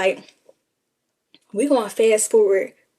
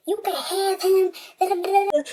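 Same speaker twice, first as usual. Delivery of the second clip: high dismissive wife; 10 semitones higher than usual. Retold or imitated. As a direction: hand him over with a high sneer. Wife voiced in phone story.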